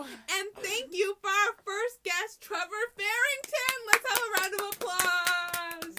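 loud, slow, high showman voice; announcer voice. Acted. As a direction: introduce the guest like a game show host. Mock announcer applause intro.

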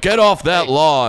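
slow grumpy dad bark; a hint of a cranky old dad. Shown as a demonstration. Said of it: "mine is just dad voice".

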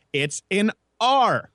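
"R" slow and high, fully pronounced; emphatic hard R. Shown as a demonstration. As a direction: draw out "R" with a hard R sound. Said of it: "There is an R in the English language".

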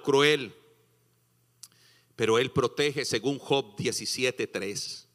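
ordinary talk

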